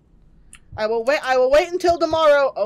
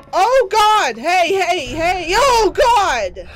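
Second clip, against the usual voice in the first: loud, high startled cries; startled yelps; 6 semitones higher, 9 dB louder, much slower than usual. Real, not acted. Gamer yelping as the house fills with mold, loud and high. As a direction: jump back in alarm.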